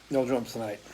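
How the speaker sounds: soft muttered echo of the line. Real, not acted. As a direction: repeat it quietly, with a shrug. Repeats the line to a viewer.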